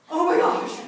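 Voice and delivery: loud, high panicked cry through a laugh; laughing shriek of shock; panicked friend. Retold or imitated. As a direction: blurt it out in laughing alarm. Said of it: "she's like".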